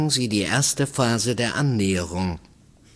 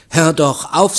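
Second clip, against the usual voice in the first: raised, louder, indignant protest; indignant rebuff; 7 semitones higher, 6 dB louder than usual. A narrator's reading. Married woman rebuffing a stranger's advance, indignant. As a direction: push him off with a louder, higher voice.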